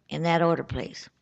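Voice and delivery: usual speaking voice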